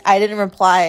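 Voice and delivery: ordinary delivery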